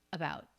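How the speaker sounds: about ending on an unreleased T; low about, final T held back; stop T. Shown as a demonstration. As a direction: cut off the T of about without releasing it. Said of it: "he does do a stop T at the end".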